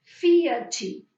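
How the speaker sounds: Fiachi said with a chi sound at the end; Brazilian pronunciation. Shown as a demonstration. Teacher showing students the Portuguese reading, loud demo. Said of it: "¿cómo se pronuncia eso?".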